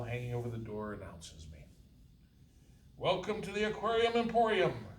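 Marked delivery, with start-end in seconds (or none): 3.0-4.9 high, loud bright shop greeting; young clerk calling out. Acted. Young clerk greets customer from behind counter. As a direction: call the welcome across the store. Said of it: "calls a young voice".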